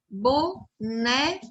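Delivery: boneca very slowly, syllable by syllable; stress on NE. Shown as a demonstration. Teacher showing children the stressed syllable, boneca slowly in separate syllables. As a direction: sound out bo-NE-ca, leaning on NE. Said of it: "O né é a sílaba tônica".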